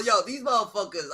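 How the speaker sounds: ordinary talk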